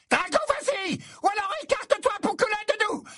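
very high, shrill yell; piercing shriek. A narrator's reading. Death Eater woman yelling at Drago, piercing shriek. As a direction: screech at the boy. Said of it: "vociféra la femme d'une voix perçante".

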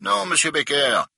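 clipped and offended; pinched tone. A narrator's reading. Director corrects Becker, pinched and prim. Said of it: "d'un ton pincé".

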